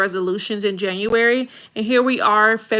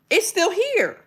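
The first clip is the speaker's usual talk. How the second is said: much higher, dismayed realization; hooked viewer's dismay; 9 semitones higher than usual. Acted. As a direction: react as if the hook hit home.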